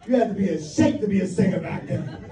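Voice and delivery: normal talking voice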